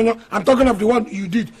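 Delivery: ordinary talk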